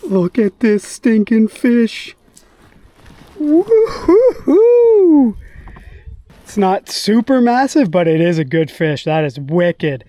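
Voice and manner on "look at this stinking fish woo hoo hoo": high, slow woo-hoo of triumph; elated whoop. Real, not acted. Angler landing the fish he wanted, whooping high. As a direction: whoop with joy at the catch.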